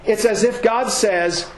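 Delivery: ordinary delivery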